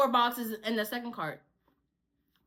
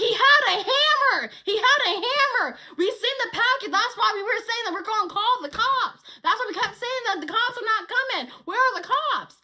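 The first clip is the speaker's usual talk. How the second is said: higher, louder, insistent; exasperated Big Show; 6 semitones higher, 4 dB louder than usual. Retold or imitated. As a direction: insist on the danger. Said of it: "He was like".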